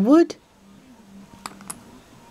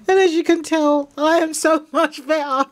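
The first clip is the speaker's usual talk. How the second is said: amused gushing; 6 semitones higher than usual. Real, not acted. Jokes about feeling better, laughing.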